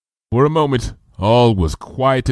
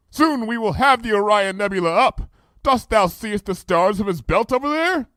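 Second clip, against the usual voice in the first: high regal voice, archaic speech; royal Luna voice; 10 semitones higher than usual. Acted. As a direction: speak as a grand princess. Luna points out stars.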